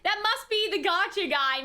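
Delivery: ordinary talk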